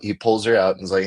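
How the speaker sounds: ordinary talk, nothing marked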